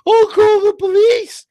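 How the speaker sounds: loud, high, drawn-out whine; mocking helpless mom. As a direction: mimic a clueless mom in a squeal. Mocks a helpless single mom.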